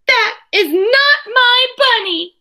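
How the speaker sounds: put-on high, loud, slow little-girl protest; upset child. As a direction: stamp it out as an outraged toddler. Trixie protests to her parents.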